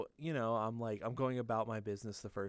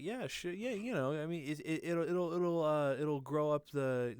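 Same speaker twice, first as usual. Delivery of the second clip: higher, stammering bluff as mom; unsure bluffing; 4 semitones higher than usual. Retold or imitated. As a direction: bluff through it, stumbling over words. Mom bluffing in retold exchange.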